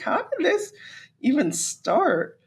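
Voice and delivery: laughing, high and broken up; laughing disbelief. Real, not acted. Laughing at the absurd internet beef between fighters. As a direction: giggle through the question. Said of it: "So I was just like".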